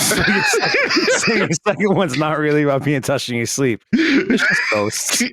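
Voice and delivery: high, breaking up with laughter; laughing hard. Real, not acted. Co-host teasing friend about the ghost fear, laughing hard. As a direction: tease through a real laughing fit.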